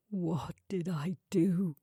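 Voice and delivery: whispered, slow mournful question; low and mournful. Acted. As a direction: ask it as if broken. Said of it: "his voice low and mournful".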